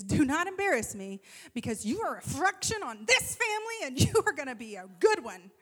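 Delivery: loud, emphatic mock scolding; stern mom lecture. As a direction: lay down the law to your kid. Mom lecturing daughter, retold.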